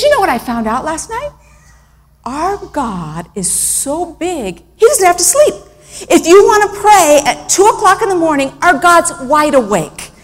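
put-on high-pitched child sharing a big discovery; excited little boy. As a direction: imitate a small boy proudly preaching. Child excitedly shares discovery about God.

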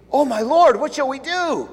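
high, loud panicked plea; a scared servant crying out to his master; frightened cry. Acted. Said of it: "the servant asked".

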